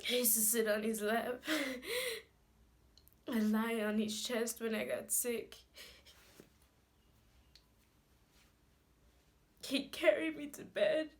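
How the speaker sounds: loud, high-pitched tearful outpouring; anguished crying. Acted. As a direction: cry it out forcefully, gasping between phrases. Grieving monologue, desperate over father's burial.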